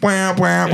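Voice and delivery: imitated spoiler siren, "wah, wah"; mock alarm. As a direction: sound a warning siren by mouth.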